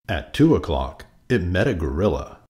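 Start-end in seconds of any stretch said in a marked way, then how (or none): none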